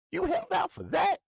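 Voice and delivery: mock shock at the holdout, voice jumping way up; incredulous. Real, not acted. Ranting at the player about his holdout, incredulous high-pitched squeak.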